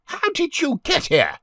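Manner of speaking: high-pitched demanding question; lady questioning an intruder. A narrator's reading. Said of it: "The lady with her hand still on the door demanded".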